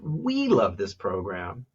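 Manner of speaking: emphasis on We to show who; stress on We. Shown as a demonstration. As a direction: hit the word We, then let the rest drop. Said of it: "So one is about who".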